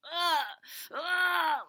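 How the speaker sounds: sharp high cries of pain, loud; retold pain cry. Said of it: "like, in so much pain".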